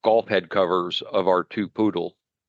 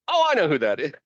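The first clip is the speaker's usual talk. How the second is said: imitating a husband who suddenly gets it, higher; raised-pitch retold realization; husband catching on; 8 semitones higher, faster than usual. Husband guessing after the hint, imitated sudden recognition. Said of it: "he goes".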